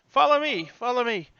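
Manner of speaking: high, singled-out call to follow; eager old porter; eager bellhop calling. Acted. Bellhop calls guests along.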